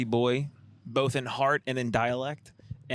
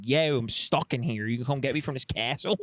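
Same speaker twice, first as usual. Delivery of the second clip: put-on Delco damsel accent. Delco damsel calling for rescue from castle, thick Delco accent. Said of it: "beautiful damsel in distress who's from Delco".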